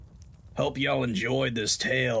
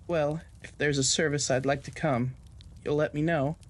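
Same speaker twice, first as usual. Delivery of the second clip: a higher, gentle voice for the nurse Clarita; 4 semitones higher than usual. A narrator's reading. Nurse Clarita consoles Colt about his loss and car, higher sympathetic voice.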